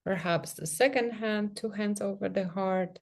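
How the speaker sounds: soft, unhurried meditation voice with long pauses; guided meditation. Real, not acted. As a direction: gently guide them into sensing the body, leaving space between phrases. Leads a guided self-touch meditation.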